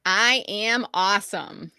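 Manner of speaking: slow, deliberate affirmation. Shown as a demonstration. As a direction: lead it slowly, word by word. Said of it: "I'm gonna say the line".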